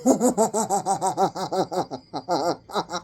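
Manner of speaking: long giggling fit; laughing. Real, not acted. Reader cracking up over the card, laughing. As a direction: let the giggles run. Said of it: "let me stop laughing".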